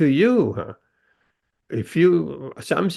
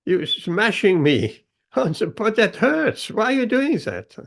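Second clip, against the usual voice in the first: higher, whiny complaining lump of clay; 7 semitones higher than usual. Acted. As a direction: squeal it like clay being smashed. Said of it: "the clay was complaining".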